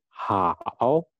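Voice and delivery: "hao" stretched slowly, dipping then rising; careful tone demo. Shown as a demonstration. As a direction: draw out "hao" to show its tone. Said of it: "Nadanya diperhatikan". ("hao" diucapkan panjang, nada turun lalu naik)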